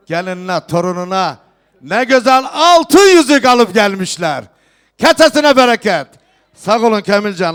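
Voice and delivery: loud festive proclamation to the hall; turkish wedding announcer. Real, not acted. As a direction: call out each gift to the guests. Host proclaims gifts at wedding.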